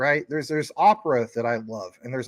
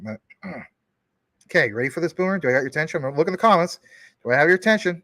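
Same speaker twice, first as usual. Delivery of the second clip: normal talking voice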